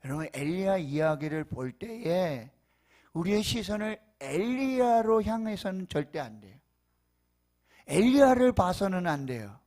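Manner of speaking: high, loud fervent preaching; preaching at full force. Real, not acted. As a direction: preach it out with rising fire.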